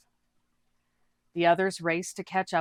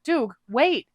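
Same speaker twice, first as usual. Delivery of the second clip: higher, louder call after a friend; yell; 8 semitones higher, 8 dB louder than usual. Acted. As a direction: call out to stop someone diving in. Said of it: "Buma yelled".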